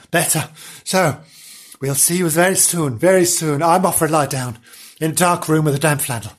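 plain reading voice

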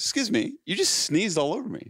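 high-pitched mock indignation; indignant stranger. Woman confronting the man who sneezed on her, mock indignant.

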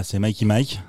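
Mikey Mike, the i sounds stressed. Shown as a demonstration. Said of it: "avec l'accent tonique sur le i".

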